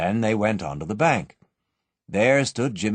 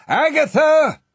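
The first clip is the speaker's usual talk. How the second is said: much higher and louder shout; calling out loudly; 8 dB louder, slower than usual. A narrator's reading. Father calling to the trapped child, loud shout. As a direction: call through the vault door. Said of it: "he called as loudly as he could".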